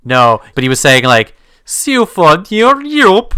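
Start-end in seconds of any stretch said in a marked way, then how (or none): 1.6-3.3 high, loud reversed-sounding gibberish; backwards speech. Pretend. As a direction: say it as if played backwards. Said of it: "Oh, that was really good".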